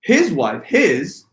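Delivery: emphasize "his" with a jump in pitch; stress on HIS. Shown as a demonstration. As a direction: hit the word "his". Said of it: "If I inflect on".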